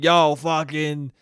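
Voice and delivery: put-on a light Italian New York accent; hint of an Italian New York accent; Italian New Yorker. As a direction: play a mock Italian uncle. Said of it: "What an Italian move to just be like".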